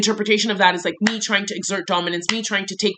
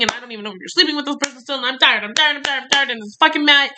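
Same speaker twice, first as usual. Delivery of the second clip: rising higher and faster, tired and fed up; exasperated rant; 6 semitones higher than usual. Retold or imitated. Retelling her outburst to him, higher and rushed.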